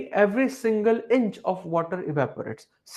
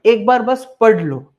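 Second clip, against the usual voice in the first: emphatic urging; 3 semitones higher, 8 dB louder than usual. Real, not acted. Teacher urging students to read.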